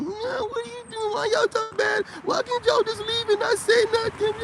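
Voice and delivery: very high, tearful mock whine; mock crying woman. Imitating grown women whining about men leaving, high crying whine. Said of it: "We're seeing grown women whining".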